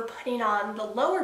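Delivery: speaking through fingers; muffled by hand; hand over mouth. Real, not acted. Seen 2: Fingers cover lips about 1.4-2.1s while talking about lower braces.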